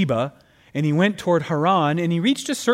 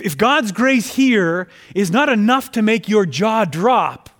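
ordinary delivery

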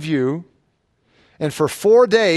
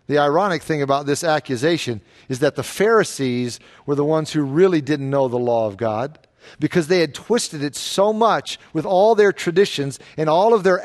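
ordinary talk

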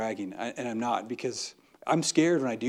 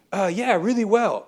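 breezy brush-off; 7 semitones higher, 6 dB louder than usual. Retold or imitated. Acted breezy small-talk answer.

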